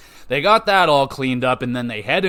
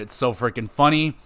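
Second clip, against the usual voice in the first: ordinary delivery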